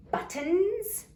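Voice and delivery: BUTTONS swooping high; high, climbing question tone on BUTTONS; question rise on BUTTONS. Shown as a demonstration. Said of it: "your voice goes up at the end".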